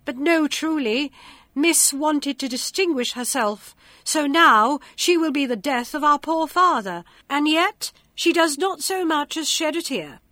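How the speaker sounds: spiteful. A narrator's reading. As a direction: sneer at her, shrill with spite. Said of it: "said all manner of ill-natured things".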